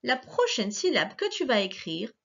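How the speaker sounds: normal talking voice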